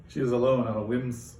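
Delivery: ordinary delivery, nothing marked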